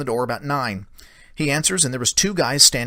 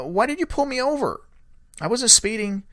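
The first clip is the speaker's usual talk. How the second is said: retold indignant question; 9 semitones higher than usual. Retold confrontation with deputy.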